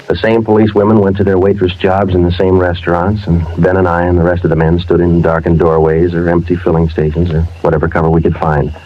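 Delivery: even, measured voiceover narration; deadpan police narrator. A narrator's reading. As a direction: tell the case like a detective's log.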